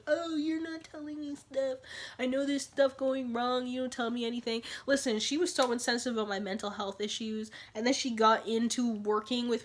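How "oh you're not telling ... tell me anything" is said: imitating whiny friend. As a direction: drag it out like an accusing friend. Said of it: "She'd be like".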